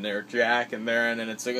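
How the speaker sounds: ordinary talk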